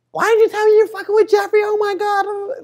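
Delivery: high-pitched shrieking mom impression; imitating his mom. Son mimics his mom's shocked reaction. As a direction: squeal it like a scandalized mother. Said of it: "my mom was just like".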